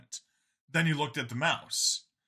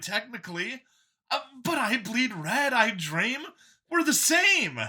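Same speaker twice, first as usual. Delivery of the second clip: higher, louder, keen voice; a cheerful zealot pitched up; android copy, eager; 11 semitones higher, 4 dB louder than usual. A narrator's reading. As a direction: sell it like a proud believer.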